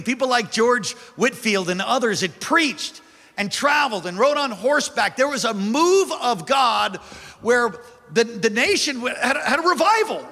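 high, driving revival preacher; full-force preaching. Real, not acted. Pastor telling church of the Great Awakening, high fervent preaching. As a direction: preach it with rising fervour.

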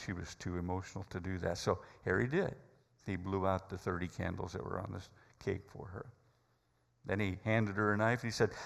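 ordinary talk, nothing marked

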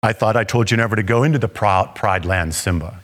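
a hint of a stern cartoon lion father; movie lion king father. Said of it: "I imagine God saying something like".

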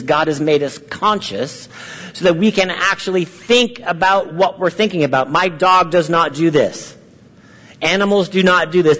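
ordinary talk, nothing marked

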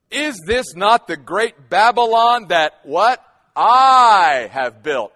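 I drawn out slow and loud; stress on I. Retold or imitated. The king boasting, stress hammered on I. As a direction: lean hard on "I have built". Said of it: "that word "I" in the Aramaic is emphatic".

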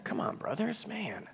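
high, soft put-on voice; mock pleading. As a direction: plead lightly, high and hushed. Playful aside on the dark quote.

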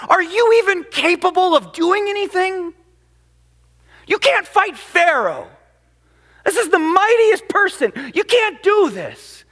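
high, loud exasperated protest; Moses complaining. Retold or imitated. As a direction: cry out at God in frustration. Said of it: "in turn, turns to God".

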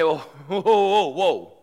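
loud, laughing whoa whoa, backing away; hammed-up balk at being asked to forgive; a laughing, over-the-top no way; mock alarmed protest.

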